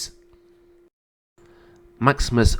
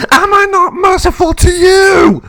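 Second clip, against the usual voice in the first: very high, loud furious shout; explode, screaming the question; enraged scream; 8 dB louder than usual. Acted. Emperor demanding his sister's answer, screaming in rage.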